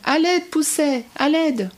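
high, drawn-out call for help; storybook howl for help. A narrator's reading. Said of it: "Le jar hurle de plus belle".

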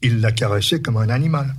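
ordinary delivery, nothing marked

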